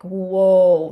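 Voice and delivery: loud, drawn-out mock whoa; big retold gut reaction; retold taken-aback reaction. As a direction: act out being taken aback. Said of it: "there was an actual visceral reaction from me".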